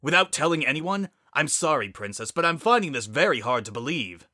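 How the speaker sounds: a father's anger breaking through politeness; voice rising. Acted. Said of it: "Zephyr's voice rose".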